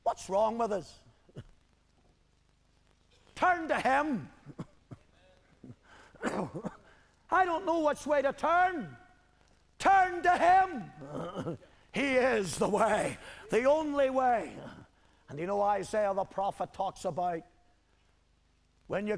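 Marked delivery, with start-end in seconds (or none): 3.3-4.3 urgent exhortation. Real, not acted. Preacher exhorts wavering believers. 7.3-14.5 high, loud, urgent exhortation; full-force preaching. Real, not acted. Preacher urging wavering believers to turn to him, full-force preaching.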